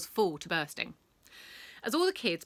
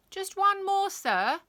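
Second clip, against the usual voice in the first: put-on cheeky girl voice; 12 semitones higher, 8 dB louder, slower than usual. Girl voice calling to teacher.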